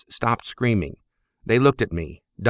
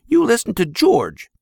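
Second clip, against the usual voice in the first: mother yelling; 13 semitones higher than usual. Acted. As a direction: shout at the husband like an angry mother. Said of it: "she yelled in Pop's ear".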